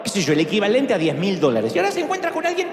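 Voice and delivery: ordinary talk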